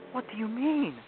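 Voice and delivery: put-on high-pitched, startled girl's question; alarmed cry. A narrator's reading. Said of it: "cried Lucy".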